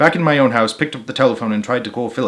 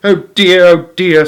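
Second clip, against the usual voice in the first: dismayed; 6 semitones higher, 7 dB louder than usual. A narrator's reading. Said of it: "said Missus Gregg".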